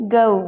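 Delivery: गौ gliding from uh to oo; diphthong. Shown as a demonstration. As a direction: draw out गौ as guh-oo. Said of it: "जी ओ को बोलना है".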